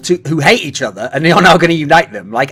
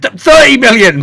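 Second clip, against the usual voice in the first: higher, louder stammered bid; mock bid; 9 semitones higher, 7 dB louder than usual. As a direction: blurt out the big number. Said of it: "It's like".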